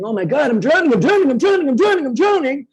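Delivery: high, rising, hammed-up panic; mock drowning panic. The surviving self flailing in the water, in mock panic, high. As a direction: cry out like you're going under. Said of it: "this energy saying".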